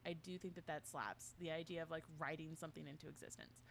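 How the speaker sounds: ordinary delivery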